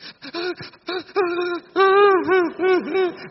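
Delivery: high-pitched mock sobs in a long run; demonstrated loud sobbing. Shown as a demonstration. Monk showing listeners what sobbing is, mock sobbing. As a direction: act out sobbing your heart out, high. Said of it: "Khóc nức nở đi".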